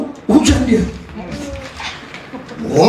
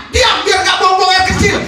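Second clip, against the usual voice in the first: joking shouted order, higher, with a laugh in it; mock command; 9 semitones higher than usual. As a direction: bark the command at your own bladder for fun. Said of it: "suruh aja ya". (perintah bercanda sambil berteriak)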